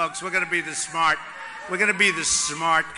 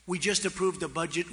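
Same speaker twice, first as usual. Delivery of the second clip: ordinary talk, nothing marked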